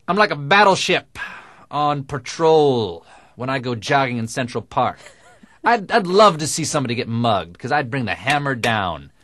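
loud, high macho bravado; quoting Geraldo. Retold or imitated. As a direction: boom it out like a tough guy. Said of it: "him once saying".

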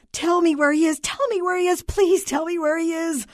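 high, loud, desperate pleading; distraught wife imploring the clerk; moaning in despair. A narrator's reading. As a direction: beg through your despair, wailing each plea. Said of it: "The voice moaned in despair".

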